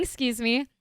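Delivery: ordinary talk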